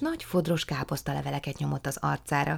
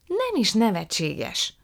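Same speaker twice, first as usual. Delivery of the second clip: slow, higher-pitched protest; annoyed; 6 semitones higher, 4 dB louder, slower than usual. A narrator's reading. Margó defends her cabbage to Prue, annoyed. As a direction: push back crossly, drawing the words out. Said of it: "mérgelődött Margó".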